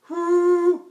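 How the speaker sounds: high ooh on leaking breath; leaky falsetto ooh. Shown as a demonstration. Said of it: "a real true falsetto requires leaky breath".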